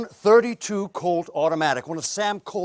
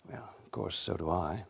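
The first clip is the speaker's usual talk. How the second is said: ordinary delivery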